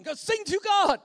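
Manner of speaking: loud, high exhortation; shouted command. Acted. Preacher giving a command for everyone, shouting. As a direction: belt it out like an order.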